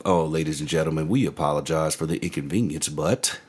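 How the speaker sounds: low-key imitation of a gate agent; airline announcer. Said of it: "right before boarding time".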